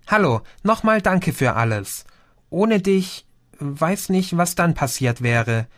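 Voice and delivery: ordinary delivery, nothing marked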